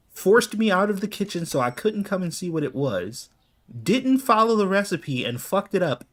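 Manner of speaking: mock-outraged complaint climbing in pitch; exasperated rant. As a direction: vent at the wife in disbelief. Exasperated rant at his wife.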